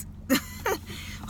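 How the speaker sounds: a short high-pitched laugh; high squeal of laughter. Real, not acted. Laughs at her own mistake.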